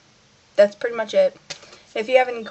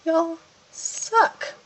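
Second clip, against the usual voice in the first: sassy retort; 7 semitones higher than usual. Retold or imitated. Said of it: "I'm like".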